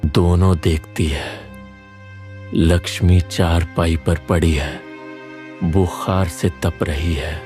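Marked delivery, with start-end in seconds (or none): none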